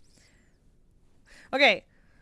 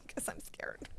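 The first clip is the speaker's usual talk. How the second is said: slow whispered joke; whispered confession; 4 semitones lower, 15 dB quieter, slower than usual. Real, not acted. Vlogger admitting she fears friction pens, whispered. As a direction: whisper it like a secret fear. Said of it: "Which is why I don't have".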